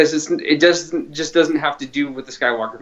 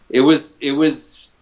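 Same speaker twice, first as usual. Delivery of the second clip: ordinary talk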